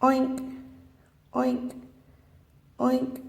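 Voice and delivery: playful pig impression; pig oink. Shown as a demonstration. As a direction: oink like a piglet for the kids. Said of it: "It goes".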